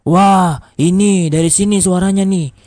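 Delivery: high, loud accusing call; shouting. A narrator's reading. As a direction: call out like an angry neighbour. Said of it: "teriak warga".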